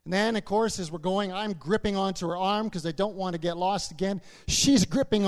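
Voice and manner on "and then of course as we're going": ordinary delivery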